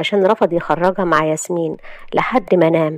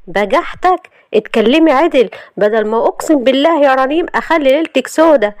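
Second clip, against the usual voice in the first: raised pitch, heated threat; angry warning; 6 semitones higher, 4 dB louder than usual. Acted. Husband warning wife to speak properly, angry. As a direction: warn her to watch herself.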